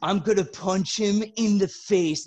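a hint of a thick-mouthed teammate; peanut butter voice. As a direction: lightly mimic talking with peanut butter on the roof of the mouth. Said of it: "This kid's voice sounds like as if you had peanut butter on the roof of your mouth".

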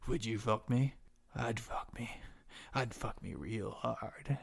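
soft, slow, breathy murmur at his reflection; creepy whisper to himself. Acted. Torturer wearing skin admires his reflection, whispering softly. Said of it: "He goes".

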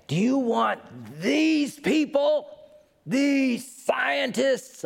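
high, slow Southern hellfire preacher. Retold or imitated. Preacher in the crowd catches the scientist's eye, slow high Southern accent. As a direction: drag it out like a Southern preacher calling her out. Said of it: "he's got a Southern accent".

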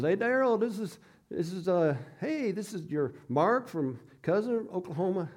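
a half-hearted Oklahoma cousin impression; Oklahoma accent. Cousin calling Daryl, light Oklahoma accent. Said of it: "in his Oklahoma accent".

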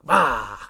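sudden loud "Ah!" to startle; jump scare. Retold or imitated. Jumping out at him in the kitchen, a loud startling "Ah!". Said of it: "I'll just be like".

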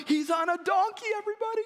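high, loud excited cry; excited crowd shouting he's on a donkey. Retold or imitated. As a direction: announce it to the crowd, thrilled.

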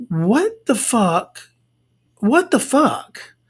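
slow, drawn-out incredulous reaction; baffled disbelief. Retold or imitated. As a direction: stretch each word in bewilderment.

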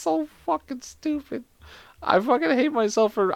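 soft, high, laughing words. Real, not acted. Ashamed of telling this joke, laughing. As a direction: speak weakly through giggles.

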